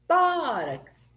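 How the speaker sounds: TÓ in "Tórax" long and high; stress on TÓ. Shown as a demonstration. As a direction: call out "Tórax", holding the first syllable. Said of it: "Quando é a penúltima que é forte".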